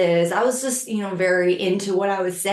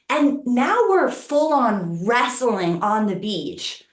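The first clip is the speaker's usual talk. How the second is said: ordinary talk, nothing marked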